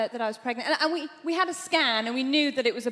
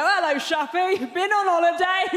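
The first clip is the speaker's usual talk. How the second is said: loud, higher hearty greeting voice; friend's dad; 5 semitones higher, 6 dB louder than usual. Acted. Friend's dad greets her at the door, loud mock-jovial. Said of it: "every time her dad opened the door".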